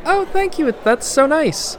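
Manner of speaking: high, slow flattered voice. Acted. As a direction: squeak out a flattered thanks. Flattered by praise.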